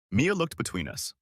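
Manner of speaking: usual speaking voice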